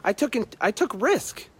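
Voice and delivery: high-pitched, casual pat on the back; playful, modest little boast; acted chill self-praise. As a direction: toss it out high and easy, with pauses. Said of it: "chill for five minutes and say".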